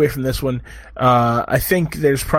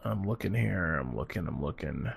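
much softer, lower, half to himself; distracted under-the-breath murmur; muttering to himself; 8 semitones lower, 15 dB quieter than usual. Real, not acted. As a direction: mumble it while reading the screen. Searching a cast list, to himself.

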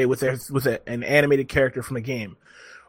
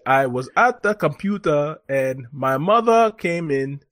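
lightly mimicked Ugandan accent; storyteller doing a Ugandan accent; imitated Ugandan accent. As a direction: retell it in a mock Ugandan accent. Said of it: "this whole thing in the accent".

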